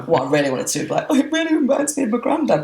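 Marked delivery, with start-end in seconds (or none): 1.1-2.6 very high-pitched comic wail; hammed-up blubbing confession about granddad; mock tearful outburst. Acts what she wanted to blurt.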